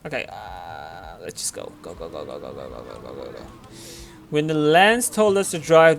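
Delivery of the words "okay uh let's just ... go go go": very low, hushed self-talk; quiet, low, repetitive mumble while busy playing; muttering to self. Real, not acted. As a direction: mutter it under your breath, much softer and lower.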